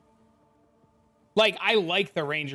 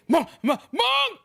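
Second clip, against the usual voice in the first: much higher, louder mock squeal; mock shriek; 6 dB louder, slower than usual. Streamer mimics a frantic player shrieking monk, hammed-up. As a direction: squeal the word like a frantic fan. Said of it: "I constantly get".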